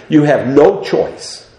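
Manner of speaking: normal talking voice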